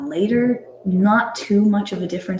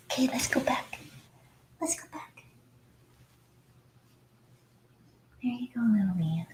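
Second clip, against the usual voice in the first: whispered coaxing to a newborn pup; soft whisper to puppy; 9 dB quieter, faster than usual. Real, not acted. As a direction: coax the puppy softly.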